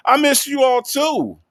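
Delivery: high, loud burst of joy at the chat; excited reply. Real, not acted. Telling the chat she missed them, high and loud with excitement. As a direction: gush it out to viewers, thrilled. Streamer answers a viewer who missed her.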